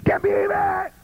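high mocking call-out of a worship leader; hammed-up rally cry; mock hyped call. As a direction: shout it up to the crowd like a showman.

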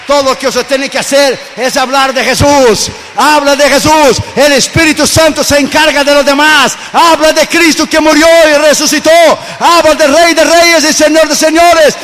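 high, loud preaching shout; fiery preaching. Acted. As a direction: thunder it from the pulpit. Preaching at full force.

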